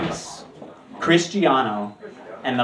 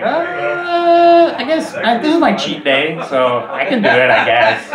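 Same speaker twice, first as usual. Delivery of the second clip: reluctant mock agreement; 10 semitones higher, 6 dB louder, slower than usual. Reluctantly agreeing to whipped cream.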